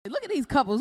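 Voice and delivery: usual speaking voice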